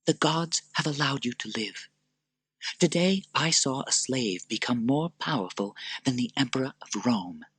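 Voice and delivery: high, soft urging woman; earnest Lucilla. Acted. Lucilla urging Maximus, high and soft. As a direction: urge him gently.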